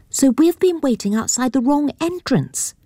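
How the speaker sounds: put-on high, girlish and let down; a young lady realising her mistake; disappointed girl. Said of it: "The girl's disappointment is evident".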